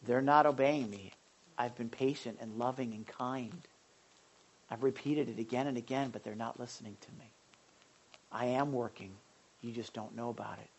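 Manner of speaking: low, hushed voice of God; restrained divine rebuke, spoken softly; God's reply. Acted. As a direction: answer quietly and low, with pauses. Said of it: "He's like".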